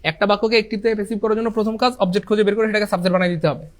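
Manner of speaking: ordinary delivery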